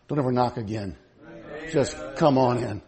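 hushed, reassuring welcome; gentle invitation. Retold or imitated. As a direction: invite them in quietly, as God would. God's gentle invitation after grandma's holler.

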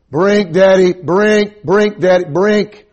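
put-on high, loud repeated toddler demand; child's insistent cries. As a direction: keep crying out for a drink to Daddy.

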